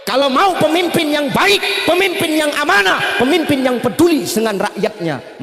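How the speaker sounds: high, loud climax of the sermon; preacher at full force on good leaders; fiery preaching. Real, not acted. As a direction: drive the point home to the crowd. Preacher exhorts the crowd.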